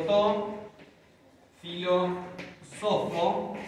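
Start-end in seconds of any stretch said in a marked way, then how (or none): 1.6-3.5 filósofo drawn out slowly, syllable by syllable; slow demo pronunciation. Shown as a demonstration. Teacher models the dative form slowly. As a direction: say filósofo slowly so they catch it. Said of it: "desplazo el acento en lugar".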